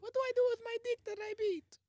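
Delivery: put-on high, whiny kid voice. Son asking his dad, high childlike voice.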